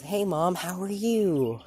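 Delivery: high, loud mock-bright hello; forced cheerful greeting. Deku greeting the mother who abandoned him, forced high cheer.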